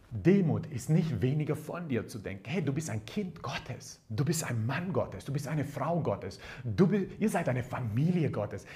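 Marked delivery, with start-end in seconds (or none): none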